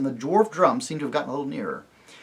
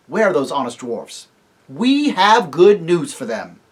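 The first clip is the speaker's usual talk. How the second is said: slow, louder triumphant declaration; rally the company; loud, rousing; 4 semitones higher, 8 dB louder, slower than usual. Acted. Tirian rouses his party aloud, no more whispering. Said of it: "not this time in a whisper".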